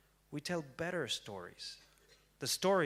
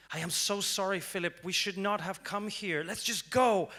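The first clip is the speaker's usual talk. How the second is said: panicked apology; 6 semitones higher, 6 dB louder than usual. Retold or imitated. Panicking narrator apologizing to Philip.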